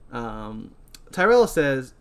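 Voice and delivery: usual speaking voice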